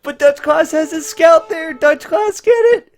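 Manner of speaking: very high, loud cheering on the snipe; frantic cheering. Real, not acted. Caster screaming at Dutch Class to kill the monk, frantic high cheer. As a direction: scream for him to get it.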